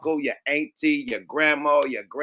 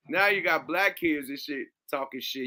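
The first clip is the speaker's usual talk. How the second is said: ordinary talk, nothing marked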